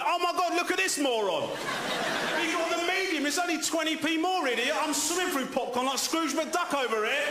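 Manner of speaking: high, sneering jeer; mock the idiot with the medium; high taunting crowd voice. Crowd mocking him.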